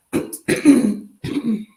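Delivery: loud clearing of the throat; a real throat clear mid-talk. Real, not acted. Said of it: "I had to clear my throat".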